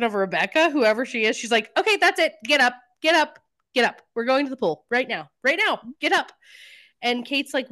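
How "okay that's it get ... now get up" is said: high, louder retold command; imitated no-nonsense rallying; insistent ordering. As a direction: act out a mother rousing her daughter, urgent and emphatic. Mother ordering daughter up to pool.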